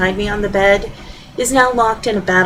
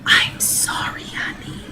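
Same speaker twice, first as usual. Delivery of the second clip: hushed voiceless whisper; soft husband's whisper; 7 dB quieter, slower than usual. Acted. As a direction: breathe it as if not to disturb anyone. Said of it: "still whispering".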